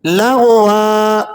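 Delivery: لغوا with the closing ا drawn out slowly; long final a. Shown as a demonstration. Teacher pausing on لغوا with a long vowel. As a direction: stretch the ا on the stop. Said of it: "تھوڑا سا کھینچ کر پڑھ رہے ہیں".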